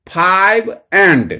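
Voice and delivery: very slow, drawn-out model reading; पढ़कर दिखाना. Shown as a demonstration. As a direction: stretch each word for the learners. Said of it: "तो कैसे करेंगे". (बहुत धीरे, खींचकर पढ़ना)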